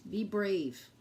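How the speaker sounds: plain reading voice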